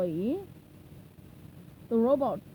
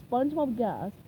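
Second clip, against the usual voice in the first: ordinary delivery, nothing marked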